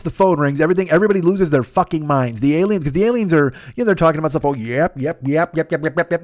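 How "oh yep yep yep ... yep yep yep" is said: alien yip talk. Retold or imitated. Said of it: "They're doing all that stuff".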